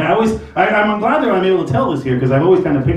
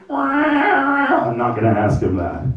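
high slow whine; stall, whining to the cat; whiny refusal; 10 semitones higher, slower than usual. Retold or imitated.

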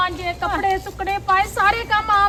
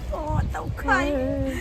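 slow, soft, weepy complaint; milk the sorrow; mock tearful lament; 4 semitones lower, 6 dB quieter, much slower than usual. Old woman lamenting her hard life to her friend, weepy.

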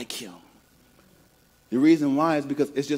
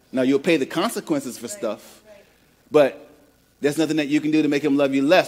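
normal talking voice

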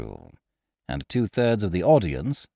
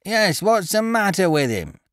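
echoing shout; 10 semitones higher than usual. Acted. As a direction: shout it back at the stage. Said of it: "echoed we of the better element".

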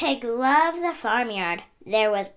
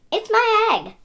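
high, louder, proud claim; 12 semitones higher, 8 dB louder than usual. Acted. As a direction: show off the egg. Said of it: "said Pig proudly".

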